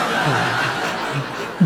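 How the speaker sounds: airy, low laughter; breathless laugh. Real, not acted. Comedian laughing after the doctor joke, airy laugh.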